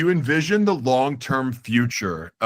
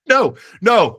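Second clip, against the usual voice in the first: excited; 9 semitones higher, 7 dB louder than usual. Real, not acted. Host catches his own mistake.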